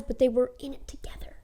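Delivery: a whispered secret; conspiratorial whisper. Acted. A teller revealing the twins' scheme to her viewers, whispering. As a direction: hush it like a shared plot. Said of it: "conspiratorial whisper".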